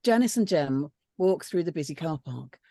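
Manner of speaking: ordinary delivery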